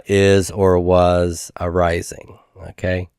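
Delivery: ordinary delivery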